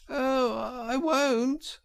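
high, very slow, quavering put-on old woman; feeble washerwoman. A narrator's reading. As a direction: Toad faking frailty. Toad in washerwoman disguise.

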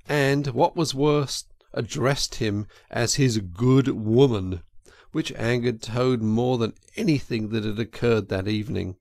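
ordinary delivery, nothing marked

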